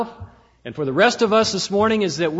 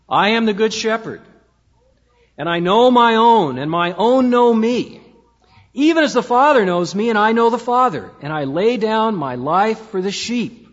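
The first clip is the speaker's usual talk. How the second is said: loud, raised, declaimed Bible verse; scripture reading; 4 semitones higher, 5 dB louder than usual. Real, not acted. Preacher reads the Good Shepherd's words, declaimed scripture reading. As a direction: proclaim the verses with weight.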